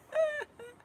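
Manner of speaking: high, joyful burst of laughter. Real, not acted. Mother laughing with joy about the gift. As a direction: laugh out in delight.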